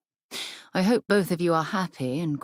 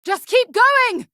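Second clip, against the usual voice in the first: much higher, loud and slow, shouting over danger; urgent shout; 11 dB louder, slower than usual. Acted. Companion urging the crew forward through danger, high loud shout. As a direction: yell at them to push on.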